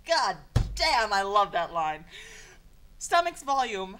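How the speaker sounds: ordinary delivery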